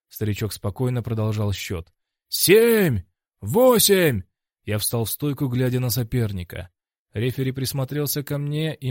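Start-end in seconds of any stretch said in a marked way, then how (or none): 2.3-4.2 loud, high-pitched number; referee's shouted count. Acted. Referee counts over the rising fighter, loud high shout. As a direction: call the count out forcefully and high.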